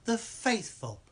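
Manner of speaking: high, hammed-up southern preacher "The faithful". Mocking the cult's catchphrase in a high southern preacher voice. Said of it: "in that southern way".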